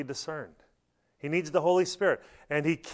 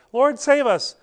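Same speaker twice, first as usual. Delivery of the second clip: high, loud plea for rescue; panicked cry; 10 semitones higher, 8 dB louder than usual. Acted. Disciples crying to Jesus in the heaving boat, loud panicked. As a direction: shout it as the waves come over.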